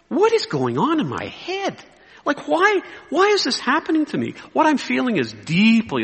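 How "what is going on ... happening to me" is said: high, bewildered inner plea; exasperated self-questioning. Retold or imitated. Pastor asking himself why this is happening, exasperated. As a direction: ask yourself in pained disbelief.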